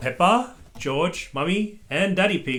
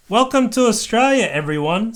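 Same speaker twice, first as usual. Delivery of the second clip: welcoming; 9 semitones higher, 8 dB louder than usual. A narrator's reading. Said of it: "said Missus Rabbit".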